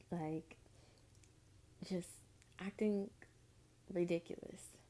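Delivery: a hushed aside; whispered. Real, not acted. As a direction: whisper the word. Whispered aside about his behaviour.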